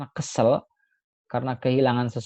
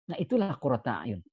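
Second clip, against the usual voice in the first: ordinary delivery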